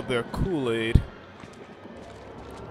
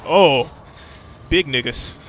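loud startled shout; startled yell; 2 semitones higher, 11 dB louder, slower than usual. Real, not acted. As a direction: jump at the sight. Sudden monster appears.

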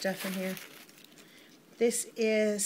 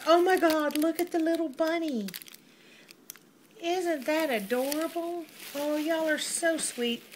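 delighted; 6 semitones higher than usual. Real, not acted. Delighted by the bunny in the box.

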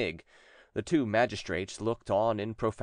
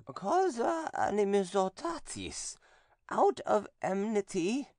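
much higher, slow, eerie possessed voice; possessed nun; 11 semitones higher, slower than usual. A narrator's reading. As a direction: speak as the demon inside her, slowly. Possessed nun answers exorcist.

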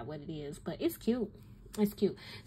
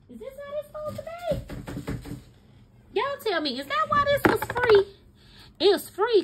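much higher and louder, frustrated shout; exasperated outcry; 6 dB louder, faster than usual. Real, not acted. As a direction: wail at the broken free pen. Frustrated that free pen is broken.

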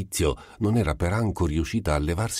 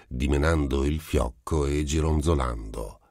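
usual speaking voice

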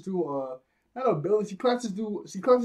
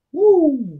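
high, loud "woo"; whooping cheer; 11 semitones higher, 12 dB louder than usual. Acted. Host mock-cheering at Lady Alvida's looks, loud high whoop. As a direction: let out a big mock whoop.

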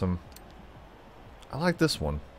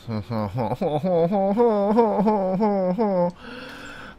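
drawn-out laugh climbing high in pitch; long rising laugh; 10 semitones higher than usual. Pretend. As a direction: stretch the laugh out, higher and higher. Playful laugh after mock accent.

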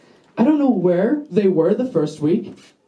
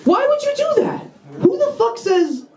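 very high-pitched comic rant; incredulous outrage. Acted. Comic reacting to the teacher's refusal, incredulous outrage. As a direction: shriek it in exasperated disbelief.

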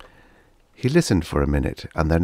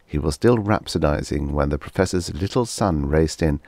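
plain reading voice

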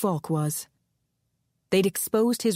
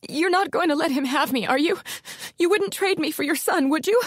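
higher, panicky pleading questions; hysterical; 10 semitones higher than usual. A narrator's reading. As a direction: fear spilling over into panic. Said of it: "Ilona Fry was turning hysterical".

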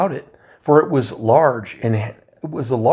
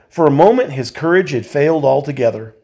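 ordinary delivery

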